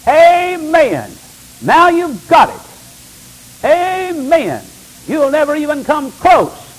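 high, loud, ringing amens; preaching at full force. Real, not acted. Preacher answering his flock with loud high amens. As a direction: proclaim it over the whole church.

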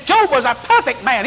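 high-pitched indignant insistence, imitated; Willis Walker. Seminary student nearly fighting his teacher, high heated protest. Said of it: "I thought they was gonna have a fight".

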